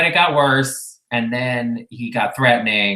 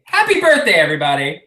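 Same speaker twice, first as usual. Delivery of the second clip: sarcastic cheer; 3 semitones higher, 4 dB louder, faster than usual. Real, not acted.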